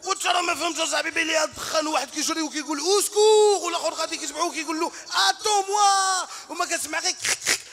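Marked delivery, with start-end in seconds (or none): 2.8-3.6 high, loud shouted call; a comic retold yell; mock shout. Soldiers shouting in retold chaos. 5.3-6.3 loud high shouted call; comic retold yell; mock shout. Soldiers shouting in retold chaos.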